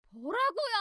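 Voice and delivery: high, soft-edged indignant squeal; outraged disbelief. Acted. Sister-in-law stung by an insult, outraged. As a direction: throw it back as an offended high question.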